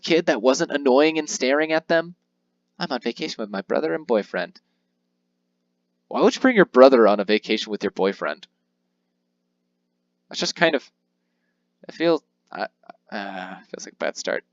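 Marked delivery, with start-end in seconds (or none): none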